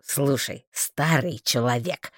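low, contained irritation; mildly cross, deep voice; angered. A narrator's reading. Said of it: "обозлился Марстон".

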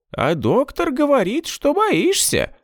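high teasing puppet cat; squeaky, drawn-out toy voice. A narrator's reading.

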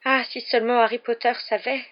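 plaintive house-elf moan. A narrator's reading. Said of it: "gémit Dobby".

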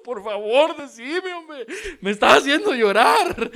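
high, hammed-up crying plea; imitated weepy begging; mock tearful pleading. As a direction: beg through pretend sobs, building to a loud wail. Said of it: "yo me pongo a llorar también".

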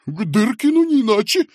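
high, loud, flustered reply; frightened babble. A narrator's reading. Fat man advising the clerk, frightened babble. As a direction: blurt it out in a fluster. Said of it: "пролепетал толстяк".

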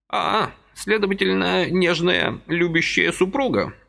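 put-on high fussy old man's voice, gently reproaching; a little old man chiding kindly; old man reproaching Vasily for his haste, high and fussy; reproachful old man. A narrator's reading.

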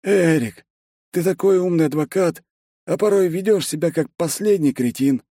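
high-pitched, reproachful character voice; exasperated secretary. A narrator's reading. Secretary scolding his lawyer boss, high and exasperated.

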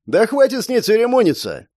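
high, impatient cry of a thug; shouting. A narrator's reading. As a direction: call out impatiently to an accomplice. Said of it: "крикнул второй мужчина". (нетерпеливый окрик)

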